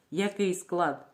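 ordinary talk, nothing marked

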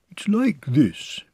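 high, slow storybook bear; Pooh explaining earnestly. A narrator's reading. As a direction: draw it out, high and deliberate.

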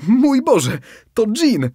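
high, loud amazed exclamation; astonished cry. Acted. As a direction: gasp it out in shock at the genie. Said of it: "wykrzyknął zdumiony rybak".

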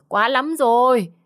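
high, loud, drawn-out cry of outrage; furious outburst. A narrator's reading. Mother fed up with defiant son, furious outburst. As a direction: stretch it into an angry yell. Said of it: "Bà Tư nghe xong tức lắm".

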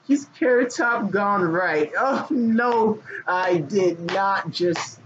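high, slow oh no; shocked. Real, not acted. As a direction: gasp at your own joke. Shocked at own joke.

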